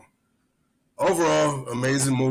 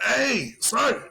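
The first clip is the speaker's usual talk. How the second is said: calling out to sergeant; 10 semitones higher, slower than usual. Retold or imitated. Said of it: "I was like".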